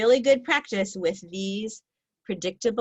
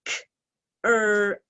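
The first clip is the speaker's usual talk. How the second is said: each sound drawn out; stretched sounds; 5 dB louder than usual. Shown as a demonstration. Teacher stretching croak for the class, drawn out. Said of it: "Let's stretch it together".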